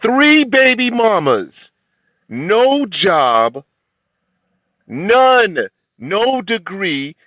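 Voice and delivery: high, drawn-out emphatic list; indignant listing. Real, not acted. Indignantly listing the man's failings.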